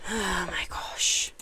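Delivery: soft breathy whisper of defeat; whispered dismay. Real, not acted. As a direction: mutter it under your breath, beaten. Whisper after being killed in game.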